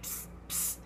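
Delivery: a breathy, voiceless psst to catch attention; sneaky psst at the boys; whispered psst. Retold or imitated. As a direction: hiss psst across the street to get noticed. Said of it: "trying to get their attention".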